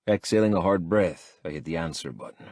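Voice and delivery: plain reading voice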